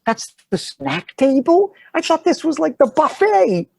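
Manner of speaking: very high, slow disbelief; incredulous. Retold or imitated. New actor learns the food was only snacks, incredulous. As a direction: act flabbergasted in a squeaky high voice.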